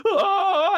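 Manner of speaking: high, loud laughing mock scream; enemy scream. Heroin addict charging from behind, loud high shriek. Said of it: "you just hear, like".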